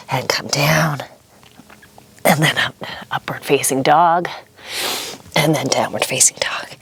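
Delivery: breathy whisper while moving; whispered, straining. Real, not acted. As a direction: whisper it through the effort. Breathless during the flow.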